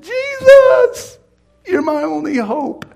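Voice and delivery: very high, drawn-out wail; desperate cry. Retold or imitated. Tormented man calling on Jesus from his deathbed, desperate high cry. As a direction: cry the name out at the top of the range. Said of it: "And I went".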